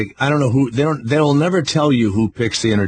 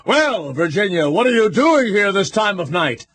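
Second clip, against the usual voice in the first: theatrical, drawn-out stage voice; hammy stage actor; 8 semitones higher than usual. Retold or imitated. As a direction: overact like old theater. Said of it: "They go".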